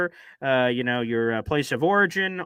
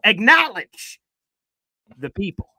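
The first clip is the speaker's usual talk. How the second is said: booming call-out; 2 semitones higher, 6 dB louder than usual. Pretend. Mock announcer call-out.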